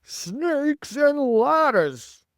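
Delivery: very high, loud, long-drawn yell; alarmed yell. A narrator's reading. Fire chief seeing Miss Spider's head, yelling in alarm. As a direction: stretch the exclamation into a big yell. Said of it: "yelled the head of the fire department".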